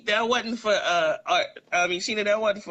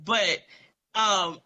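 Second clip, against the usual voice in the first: ordinary talk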